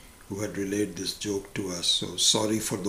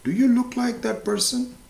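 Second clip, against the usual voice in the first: mock doctor, pitched much higher; hammed-up incredulous questioning; psychiatrist reasoning; 14 semitones higher, 5 dB louder than usual. Psychiatrist points out the cat to patient, pitched higher. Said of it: "and says".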